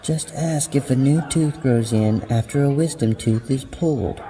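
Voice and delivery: put-on light, high voice for a girl talking to her friend; teenage girl asking.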